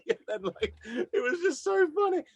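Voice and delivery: high, laughing through the words. Real, not acted. Singer recalling the pie and peas gig, laughing. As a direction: giggle it out, still amused.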